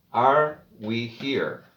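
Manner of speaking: "Are we here?" very slow, teacher model; slow model question. Shown as a demonstration. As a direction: spell out "Are we here?" slowly for students. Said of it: "para que usted practique la pronunciación".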